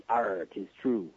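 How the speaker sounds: low, slow pirate voice. Retold or imitated. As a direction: drawl it out like a stage pirate. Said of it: "spoken like a pirate".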